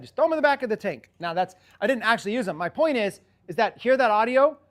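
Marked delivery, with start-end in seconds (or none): 0.0-1.1 higher and fast, tossed off; retelling his fast ad line; quick casual pitch. Retold or imitated. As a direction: rattle it off like the ad. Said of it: "that's why I said".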